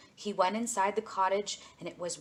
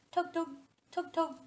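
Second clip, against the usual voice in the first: tugging sound; 10 semitones higher than usual. A narrator's reading. Narrator acting out tugging line.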